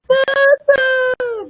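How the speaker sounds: high, loud tearful cry of a name; crying out her name. Retold or imitated. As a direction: call her name out high, half sobbing. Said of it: "I was just like".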